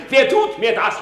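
high, loud enraged cry; furious shout. Acted. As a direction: hurl the question out in fury. Hamlet's monologue rising in rage. (wütend herausgeschrien)

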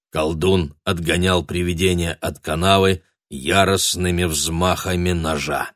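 plain reading voice

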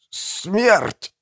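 high, strained, effortful croak; dying croak. A narrator's reading. Dying Bertram calls for death, strained croak. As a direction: force the word out hoarsely. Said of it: "прохрипел Бертрам".

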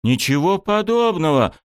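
high-pitched outraged denial; indignant yell. A narrator's reading. Student breaking free from coach, indignant yell. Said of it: "не менее возмущённо завопил я".

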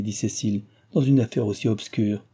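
usual speaking voice

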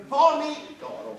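pomi d'oro said slowly and separated; carefully dictated name; spelling out the name. Shown as a demonstration. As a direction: split "pomi d'oro" into two clear words, slowly. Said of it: "né singolare né plurale".

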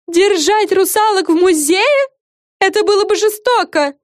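high, loud, outraged fairy voice; high-pitched storybook indignation; indignant. A narrator's reading. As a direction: protest indignantly in a high character voice. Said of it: "возмутилась Френ".

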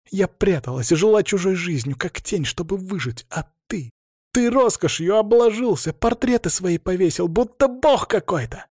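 high-pitched, wounded outburst, voice straining; overwrought accusation. A narrator's reading. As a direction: build from hurt to scornful outrage. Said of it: "голос сорвался на хрип". (с надрывом, срываясь от обиды)

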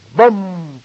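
high, loud ringing "bam"; imitated chime. A narrator's reading. The loudspeaker sounding midnight, chime imitation. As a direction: strike it like a bell. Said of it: "прозвучало в громкоговорителе".

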